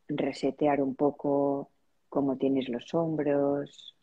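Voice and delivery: spacious, measured relaxation-practice voice; guided meditation. Real, not acted. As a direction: lead them gently into noticing their breath, unhurried. Leads a breathing meditation.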